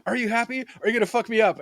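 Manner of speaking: high, giddy overacted taunt; hammed-up thrill at danger; mock manic glee. Quoting over-the-top character.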